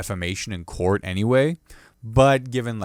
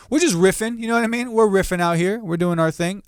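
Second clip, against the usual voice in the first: pitched way up, buzzing with energy; hyped riffing; 9 semitones higher than usual. Real, not acted. Comic riffing alone on the podcast, high excited voice. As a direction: hype yourself up, high and bright.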